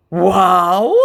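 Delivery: loud put-on scammer voice; a stunned, hammed-up wow; mock astonished scammer. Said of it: "said my scammer".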